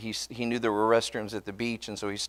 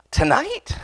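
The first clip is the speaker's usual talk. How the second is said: surprised question; 4 dB louder than usual. Retold or imitated. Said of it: "And he goes".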